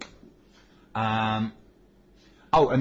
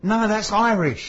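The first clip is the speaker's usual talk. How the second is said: loud protest; 10 semitones higher, 7 dB louder, slower than usual. Real, not acted. Arguing the accent is Irish.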